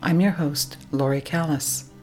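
ordinary delivery, nothing marked

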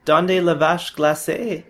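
slow, loud, high "Donde la vache glacée" in mangled Spanish-French; hopeful, slow over-articulated tourist Spanish-French; mixed Spanish and French. Acted. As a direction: spell "Donde la vache glacée" out slowly and loudly to a foreigner. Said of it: "this time mixing in French".

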